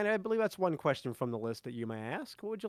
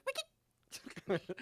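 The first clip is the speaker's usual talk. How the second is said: squeak; 7 semitones higher, 6 dB quieter than usual. Acted. GM voices squeaking puppet creature.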